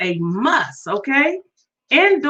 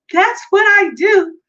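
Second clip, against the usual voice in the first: higher, louder proud repeat; emphatic and louder; 7 semitones higher, 4 dB louder than usual. Shown as a demonstration. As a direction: punch it out with pride.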